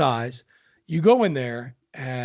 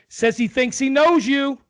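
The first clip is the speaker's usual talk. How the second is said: put-on higher, louder, slow old husband; old husband's voice; 12 semitones higher, 6 dB louder than usual. Old husband to deaf wife in car, loud slow. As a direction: bark it slowly at a deaf wife. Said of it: "With accents and all".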